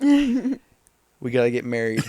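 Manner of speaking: loud, drawn-out mock plea; joking mock proposal. Teasing a friend about numerology matches, joking mock proposal. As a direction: draw it out loudly as a teasing joke. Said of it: "I just make jokes like this".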